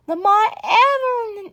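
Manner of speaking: ordinary delivery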